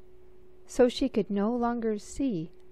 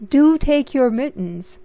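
fussy father; 6 semitones higher, 11 dB louder than usual. Acted. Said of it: "said her father".

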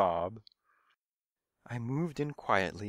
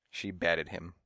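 ordinary delivery

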